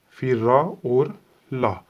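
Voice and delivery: very slow, each part held apart; syllable by syllable. Shown as a demonstration. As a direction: read पा, रा, सा out like a teacher. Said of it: "ये हमारा पढ़ने का तरीका है". (धीरे-धीरे पढ़ना)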